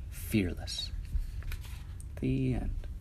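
soft hushed ending; whispered. A narrator's reading. Storyteller closing the tale, hushed. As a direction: breathe the last word.

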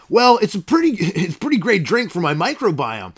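high, beaming salesman voice in a skit; pushy pitchman. Acted. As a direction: sell it like a cheesy TV ad guy. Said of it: "I got a commercial coming up".

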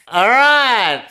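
high, loud drawn-out call; loud announcement. Retold or imitated. Rod acted announcing a match.

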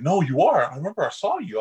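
a hint of an excited cashier; insistent cashier. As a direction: play the cashier who won't back down.

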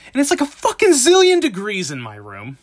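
usual speaking voice

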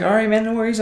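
high-pitched, mildly surprised assent; kinda surprised. Retold or imitated. Guest agreeing to the owner's order, kinda surprised. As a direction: agree readily, voice jumping up in surprise. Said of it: "I was kinda surprised".

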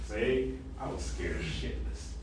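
hushed and voiceless, a secret admission; a quiet aside owning up to fear; whispered confession. Retold or imitated. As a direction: let it slip out as a whisper.

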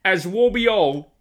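Aswarby said as "As will be all" in a put-on British accent; hammed-up British. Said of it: "say it in the accent".

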